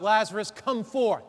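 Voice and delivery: calling out. Retold or imitated. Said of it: "Jesus calls out".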